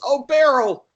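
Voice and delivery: put-on high, sugary greeting to an old friend; Peter's sweet voice. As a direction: chirp it high, all delight. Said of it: "Peter immediately notices her and says".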